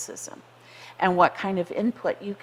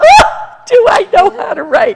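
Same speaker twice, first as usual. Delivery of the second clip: tearful; 16 dB louder than usual. Retold or imitated. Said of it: "I burst into tears".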